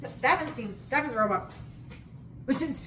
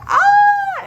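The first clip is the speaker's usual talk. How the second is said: high joyful shriek; loud high-pitched squeal of delight; excited scream; 16 dB louder than usual. Retold or imitated. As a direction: shriek with giddy joy. Screams with joy at news.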